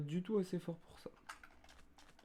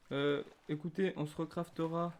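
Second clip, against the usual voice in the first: ordinary talk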